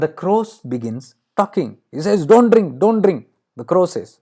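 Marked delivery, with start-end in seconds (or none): 2.3-3.3 raised pitch, louder, urgent repeated warning. Acted. Crow stopping Alexander from drinking the water, urgent warning. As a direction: warn urgently in a higher, louder voice.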